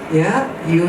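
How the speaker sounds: ordinary delivery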